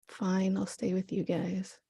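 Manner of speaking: quiet, grudging give-in; sulky mutter. Retold or imitated. Gloria giving in, sulky.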